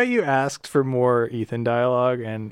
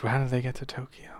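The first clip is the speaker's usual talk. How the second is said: quick breathy whisper aside; 8 dB quieter, faster than usual. Real, not acted. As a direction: mutter it fast under breath. Aside while narrating.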